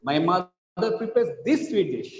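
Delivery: "this" lifted above the other words; single out "this"; stress on THIS. Shown as a demonstration. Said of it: "This, this one".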